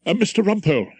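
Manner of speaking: anxious court usher calling out; urgent usher. Acted. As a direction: hurry the barrister back to court. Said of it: "he said urgently".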